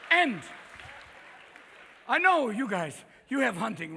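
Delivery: normal talking voice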